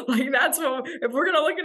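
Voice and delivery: talking through a laugh; amused, chuckling aside. Real, not acted. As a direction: let the laugh carry the words. Laugh heard.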